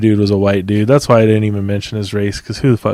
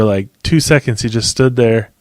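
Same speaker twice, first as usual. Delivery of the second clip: ordinary delivery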